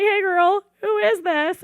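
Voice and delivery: high, loud chirpy imitation; hammed-up friendly greeting; imitating the stepdaughter. Stepdaughter greeting her stepmother, imitated high and bright. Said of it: "she's like".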